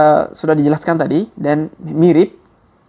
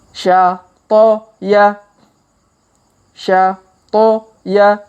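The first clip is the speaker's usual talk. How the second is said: slow reading; 4 semitones higher, 3 dB louder, much slower than usual. Shown as a demonstration. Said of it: "kita baca pelan-pelan".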